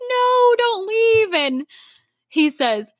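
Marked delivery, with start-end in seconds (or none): 0.1-1.3 high, drawn-out mock plea; little gingerbread man. Fan retelling the gingerbread man at the river, high pleading impression. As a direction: plead slowly in a small, high cartoon voice. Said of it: "the little gingerbread man is like".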